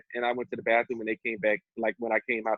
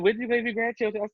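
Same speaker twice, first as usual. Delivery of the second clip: mom's nagging question, pitched up; imitating mom; 11 semitones higher than usual. Mother nagging her son about grandbabies, mocking high voice. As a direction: mimic the mother pressing him about grandkids. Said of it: "she kept saying".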